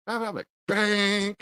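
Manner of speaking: high, singsong mock babble with loud bang; mocking chatter. Reviewer imitating her speech cut off by a shock, mocking chatter. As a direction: mimic empty chatter, then punch the bang.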